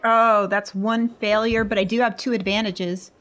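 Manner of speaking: usual speaking voice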